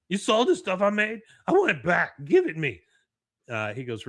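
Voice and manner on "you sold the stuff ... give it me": loud, high character tantrum; mock outrage. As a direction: protest like a cheated oddball, voice raised. Jimmy angry his art was sold.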